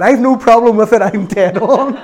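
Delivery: laughing. Real, not acted. Jokes about being small.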